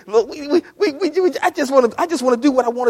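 put-on high, fast childish protest; a sinner pushing back at God; whiny rebel. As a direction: whine like a stubborn kid. Sinner kicking against God.

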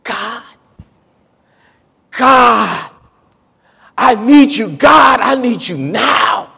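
a hurting believer crying out to God for help; desperate shouted prayer. Acted. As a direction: beg God out loud, some words drawn out slowly. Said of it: "You said".

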